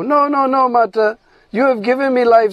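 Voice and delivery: mild acted protest, starting high; lightly acted protesting calf. Calf refuses mother's sacrifice, mildly pleading.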